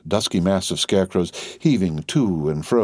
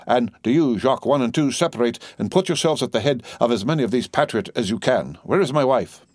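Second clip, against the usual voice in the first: usual speaking voice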